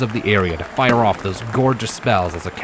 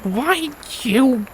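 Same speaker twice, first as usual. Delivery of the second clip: flustered. A narrator's reading. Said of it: "cheeks began to burn".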